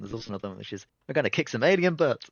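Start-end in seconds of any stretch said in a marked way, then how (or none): 1.1-2.2 loud, higher, hammed-up bravado; rally the crew with mock heroics; gung-ho battle cry. Voicing the brash dialogue option.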